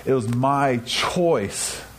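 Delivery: "It was my choice" drawn out very slowly, each word weighted; slow, emphatic repeat to drive it home; said again, slowly. Shown as a demonstration. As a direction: lean on "my choice", slow and deliberate. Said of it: "Let me really say that again".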